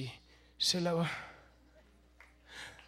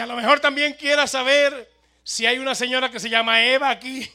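loud, higher shout; calling out; 5 semitones higher, 12 dB louder than usual. Acted. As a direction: shout the question inside. Preacher calls into the house. (pregunta a gritos)

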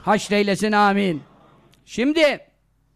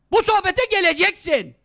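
ordinary talk, nothing marked

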